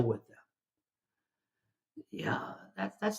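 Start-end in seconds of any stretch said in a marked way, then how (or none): 2.1-3.2 whisper. Real, not acted. Preacher affirms real love.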